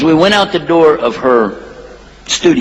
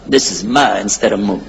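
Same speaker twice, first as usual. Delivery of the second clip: ordinary delivery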